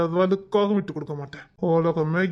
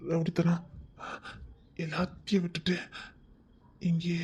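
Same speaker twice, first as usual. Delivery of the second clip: soft whispered threat; menacing whisper; 9 dB quieter than usual. Acted. Husband menacing the wife in a whisper. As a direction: breathe it out like a threat.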